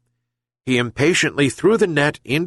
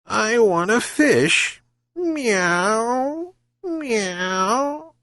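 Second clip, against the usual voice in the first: purring; 13 semitones higher, much slower than usual. Acted. Cat refuses the old man, purring for fish. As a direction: coax it out like a stubborn cat. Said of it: "she purred again".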